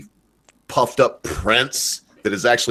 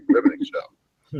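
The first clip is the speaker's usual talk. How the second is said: mock awe; 5 semitones higher, slower than usual. Mock breathless praise of PBS.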